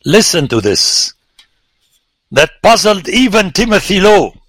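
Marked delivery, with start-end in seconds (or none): 2.3-4.3 excited. Real, not acted. Excited aside about colleague.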